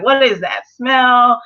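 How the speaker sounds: slow, drawn-out disgusted outburst in a put-on voice; disgusted Yellow Diamond. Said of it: "Yellow Diamond is like".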